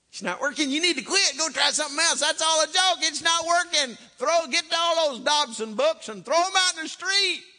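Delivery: high, loud mocking devil voice; devil's taunt. Devil whispering in a parent's ear, loud high taunt. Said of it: "devil's whispering in your ear".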